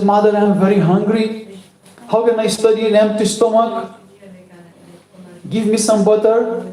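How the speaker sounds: put-on high-pitched hungry child; complaining child. A narrator's reading. Hungry son asks mother for butter, complaining. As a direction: plead for food in a high, sustained voice.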